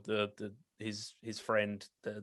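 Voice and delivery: ordinary talk, nothing marked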